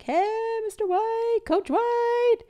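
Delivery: high-pitched mock yell of students calling out; imitated yelling. High school kids yelling at her husband from car, imitated yell. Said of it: "started yelling out the window".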